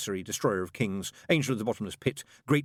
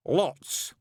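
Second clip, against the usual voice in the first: with feeling; 8 semitones higher, 4 dB louder than usual. A narrator's reading. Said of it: "he said with feeling".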